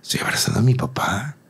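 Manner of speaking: soft whisper of an inner thought. Retold or imitated. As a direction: breathe it as if to yourself. Retold inner thought while hugged. (susurro de pensamiento íntimo)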